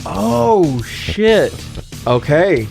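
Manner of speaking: ordinary delivery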